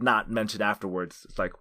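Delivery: usual speaking voice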